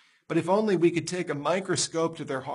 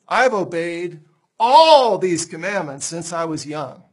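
loud, slow, self-assured protest; indignant claim; 9 dB louder than usual. Acted. Rich man boasting loudly to Jesus, slow and proud. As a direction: insist slowly and loudly on your own goodness. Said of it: "the man replied".

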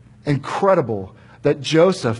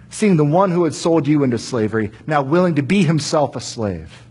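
ordinary talk

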